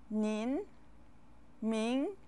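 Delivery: "Nin, Ming" each drawn out with a rising pitch; Mandarin second tone. Shown as a demonstration. Teacher listing second-tone words for learners, "Nin, Ming" each rising. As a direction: let "Nin, Ming" climb at the end, word by word. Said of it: "how many words have the second tone".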